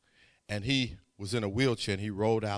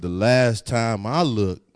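lower, slow, firm words of faith; slow faithful reply; 4 semitones lower, 10 dB louder, slower than usual. Retold or imitated. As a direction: say it with calm certainty. Antoine's faith retold.

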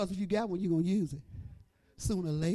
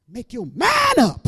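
loud, slow, higher cry of make your man up; louder, higher repeat; 7 semitones higher, 11 dB louder, slower than usual. Shown as a demonstration. Preacher repeating the line louder to the church. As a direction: build it and cry it out.

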